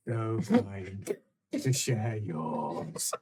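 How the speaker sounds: slow, low, savouring each word; slow sinister echo. Acted. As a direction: drag out "So kind" slowly.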